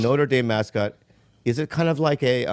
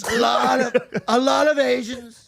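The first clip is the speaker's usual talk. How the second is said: raised throaty Trump; Trump impression; 10 semitones higher than usual. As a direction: Trump rally voice.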